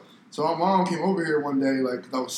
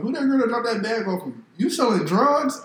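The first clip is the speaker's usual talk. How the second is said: mock girlfriend; 9 semitones higher, 3 dB louder than usual. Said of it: "My girl like".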